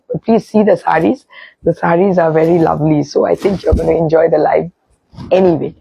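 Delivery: usual speaking voice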